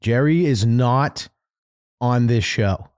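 slow, spaced-out stress on "Jerry is not"; very clear. Pretend. Making sure listeners know Jerry is absent, very clear. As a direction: spell out "Jerry is not", pause, "on this show". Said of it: "Gotta be very clear".

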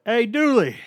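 loud, high, casual call of hey, Dooley; mocking Malik's greeting. Malik greeting the coach without his title, loud casual call. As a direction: sing out hey, Dooley like a buddy. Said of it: "Malik was always like".

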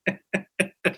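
a short burst of high, breathy laughter; quick high laugh. Real, not acted. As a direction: laugh it off lightly. Host laughs at own joke.

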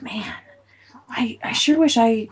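wistful whisper. Retold or imitated. Wistful inner thought.

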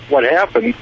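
ordinary delivery

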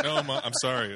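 high laughing sorry to mom; laughing mock apology. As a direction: play the scolded son. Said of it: "It's like".

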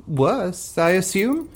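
high, slow coaxing drawl; coaxing actor. Acted. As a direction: lead him toward the catchphrase. Actor fishing for hero's catchphrase.

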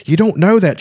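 a lightly voiced furious young woman; furious scream. A narrator's reading. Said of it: "she screamed at him in fury".

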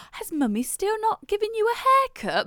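put-on high sweet baby-talk voice; mocking mum's baby talk. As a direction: needle her through the baby, sugary and high. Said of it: "She'll go".

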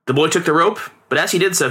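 ordinary delivery

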